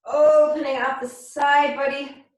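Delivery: normal talking voice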